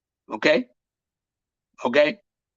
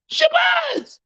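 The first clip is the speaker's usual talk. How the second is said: a much higher, shouted Shabazz!; shouted name. Acted. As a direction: cry out Shabazz! like a revelation. Answering his own question, shouting.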